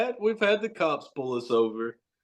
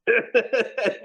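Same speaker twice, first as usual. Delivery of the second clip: high burst of laughter; 10 semitones higher than usual. Real, not acted. Laughs at his own story.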